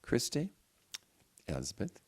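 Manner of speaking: ordinary delivery, nothing marked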